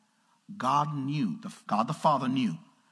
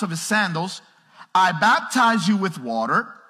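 loud, higher, slow preached scripture; full-force preaching; 9 semitones higher, 8 dB louder than usual. Acted. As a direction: proclaim it to the whole room.

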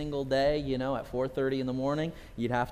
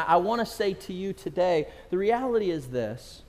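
ordinary talk, nothing marked

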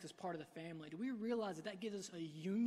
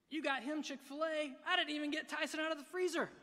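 comic aggrieved whine, pitched higher and louder; mock jealous sibling; 8 semitones higher, 7 dB louder than usual. Preacher voicing the brother in modern terms, mock whine. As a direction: exaggerate the petty grievance for a laugh. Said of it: "it's like".

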